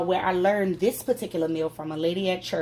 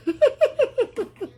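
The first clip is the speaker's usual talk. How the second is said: high laugh; 6 dB louder than usual. Real, not acted. Laughing at parsley joke.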